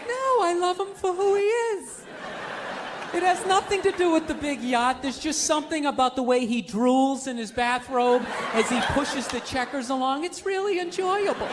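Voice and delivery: mock a woman pretending devotion; lying young woman. Young wife lying about her rich old man, mock-sincere gushing. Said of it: "they always try and lie".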